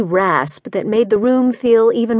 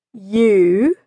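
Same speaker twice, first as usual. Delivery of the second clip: disbelieving. A narrator's reading. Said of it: "saturating the word with disbelief".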